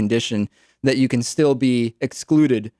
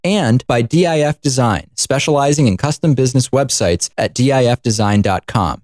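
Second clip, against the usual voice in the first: louder, upbeat underwriting announcement; sponsor read; 5 dB louder than usual. Acted. Host reading sponsors to radio listeners, announcer voice.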